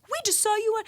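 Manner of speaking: high, excited squeal; mock gushing in a high voice; imitated excited recognition. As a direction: squeal it like a thrilled fan. Voices excited radio staff recognizing her.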